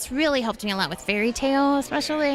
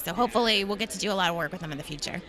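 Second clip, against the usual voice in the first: ordinary talk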